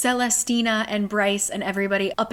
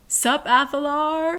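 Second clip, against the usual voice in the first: higher, mock shouted greeting called from afar; shouting; 6 semitones higher than usual. Isaiah hailing Hunt from the void, mock shout. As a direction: call it out casually across the void. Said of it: "Isaiah shouting".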